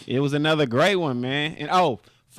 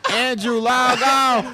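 high, loud, drawn-out call; mock shouted order; 10 semitones higher, 6 dB louder, slower than usual. Co-host jokingly ordering a friend offline, loud drawn-out holler. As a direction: holler it slowly, stretching the words.

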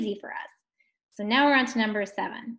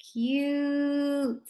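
CUTE stretched out on one held, higher-lying note; drawn-out slow; 3 semitones higher than usual. Shown as a demonstration. Teacher sounding out the word for pupils, drawn-out slow CUTE. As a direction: say CUTE slowly, vowel stretched long. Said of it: "Say it slow".

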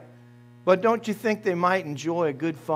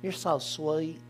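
softer, slow, mock-sweet hollow affection; mock fake love; 11 dB quieter, slower than usual. Pastor mimics hollow affection to the congregation, soft and mock-sweet. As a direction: coo it like you don't mean it. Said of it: "kind of fake love".

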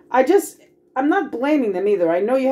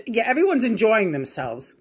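normal talking voice

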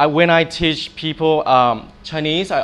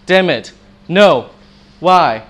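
"Damn it", "no", "why" each loud with a sharp fall; fourth tone; 5 dB louder, slower than usual. Shown as a demonstration. Teacher showing students the fourth tone, emphatic falls on "Damn it, no, why". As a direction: punch "Damn it", "no" and "why" straight down, separately. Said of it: "straight ahead".

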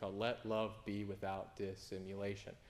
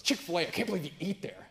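high, loud scandalised coworker voice; mock outraged coworker; 6 semitones higher, 7 dB louder than usual. Coworker shocked that Nate eats there, mock outrage. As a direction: mimic her shocked disbelief. Coworker scoffing at Chick-fil-A.

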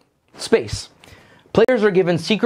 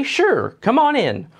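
high, cheery open welcome; friendly welcome; 10 semitones higher, slower than usual. Retold or imitated. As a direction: greet the stranger kindly. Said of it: "your instinct is to say".

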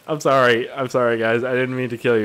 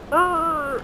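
high startled arr; startled yell. Retold or imitated. Driver skidding off the road, high yelp. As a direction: yelp a wordless high cry. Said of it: "and it's like".

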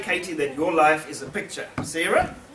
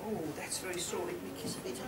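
kissing it better; 2 semitones higher, 16 dB quieter, faster than usual. Pretend. Comforting a child's sore.

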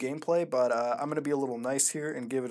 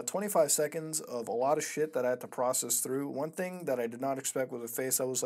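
ordinary talk

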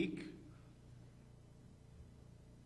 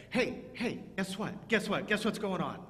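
higher, eager and a bit louder; excited news; 5 semitones higher, 4 dB louder than usual. Shown as a demonstration. Pastor showing children how excited people talk. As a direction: burst out with the news. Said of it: "when we get excited".

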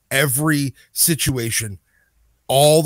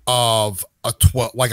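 ordinary talk, nothing marked

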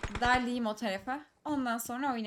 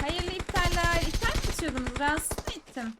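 mocking taunt; 5 semitones higher, 4 dB louder than usual. Real, not acted. Taunting enemies after killing them.

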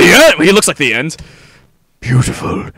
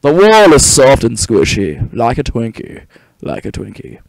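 Morgan Freeman impression; 9 semitones lower, faster than usual. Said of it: "I'd be like Morgan Freeman".

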